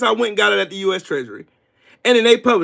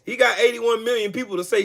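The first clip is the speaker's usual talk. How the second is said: ordinary talk, nothing marked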